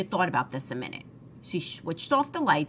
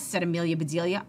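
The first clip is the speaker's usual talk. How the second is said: plain reading voice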